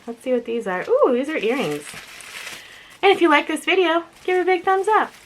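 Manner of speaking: ordinary delivery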